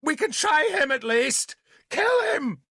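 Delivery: rising frantic scream. A narrator's reading. Nimue urging Merlin to kill Marduk, screaming. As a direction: climb into a shrieked command. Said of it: "she screamed".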